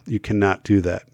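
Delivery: ordinary talk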